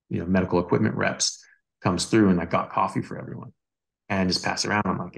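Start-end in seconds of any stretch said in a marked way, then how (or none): none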